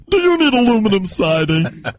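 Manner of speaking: mock aluminum siding ad, pitched above own voice; Patricia McCann. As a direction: parody a commercial, stretching out the name at the end. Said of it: "she got the voice that sounds like this".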